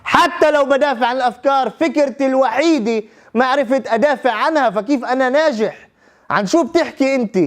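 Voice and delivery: high-pitched indignant pushback; retold exasperated rebuttal. Lawyer rejecting interviewer's praise of his career, retold exasperated rebuttal.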